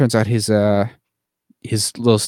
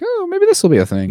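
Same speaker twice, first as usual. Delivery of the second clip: very high mock hopeful voice; hopeful falsetto. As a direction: squeak it with coy hope. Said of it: "it's like".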